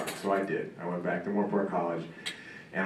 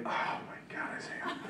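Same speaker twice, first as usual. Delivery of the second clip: disbelieving whisper; 13 dB quieter than usual. Retold or imitated. Coach's hushed dismay at acting.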